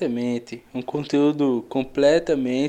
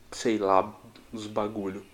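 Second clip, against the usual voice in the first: ordinary talk, nothing marked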